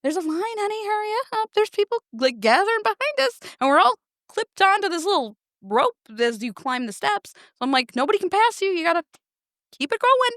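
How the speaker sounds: high, eager urging, pushing him to move; urgent hurrying. Retold or imitated. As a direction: hurry your husband up the stairs, impatient and bright. Urging slow husband up the stairs.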